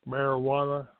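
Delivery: ordinary talk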